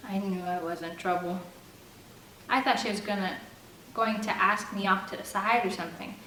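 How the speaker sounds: usual speaking voice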